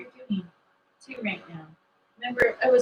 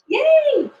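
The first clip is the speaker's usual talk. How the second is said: much higher and louder, thrilled; real excitement; 13 semitones higher, 11 dB louder than usual. Real, not acted. Cohost cheering new appointments to viewers, high and excited. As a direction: squeal it out with delight. New bookings arrive on stream.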